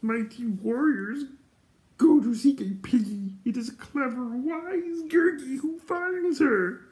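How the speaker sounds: very high, slow, drawn-out creature voice; excited Gurgi. A narrator's reading. Excited creature boasting of his deeds to friends, high gleeful crowing. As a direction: crow it out high with gleeful, stretched words. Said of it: "Gurgi was so excited".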